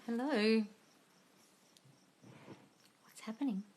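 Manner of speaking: normal talking voice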